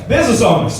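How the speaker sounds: ordinary talk, nothing marked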